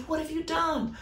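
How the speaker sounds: God pressing Cain, high and hushed. Acted. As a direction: lift it high and let it fall quiet. God's question repeated, softer.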